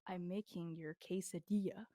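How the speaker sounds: low, quiet, deliberate retort; talking down. Retold or imitated. Server answering a customer who treats her as dumb, condescending. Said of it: "talking to me like I was dumb".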